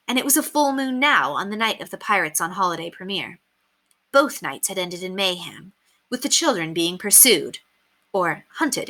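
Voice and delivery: plain reading voice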